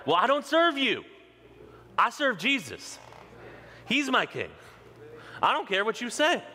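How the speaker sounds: high-pitched, emphatic defiance; defiant declaration. Acted. Defiant answer to critics.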